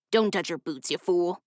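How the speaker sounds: a hint of a rough thug snapping at his partner; gruff man. A narrator's reading. Thug scolding his partner in the cart, lightly gruff. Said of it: "the gruff man said".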